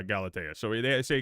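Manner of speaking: normal talking voice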